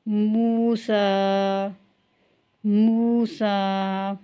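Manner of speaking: slow drawn-out Quranic reading; tajweed recitation. Shown as a demonstration. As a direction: recite each word for students to repeat. Recites practice words. (धीमी तिलावत वाली आवाज़)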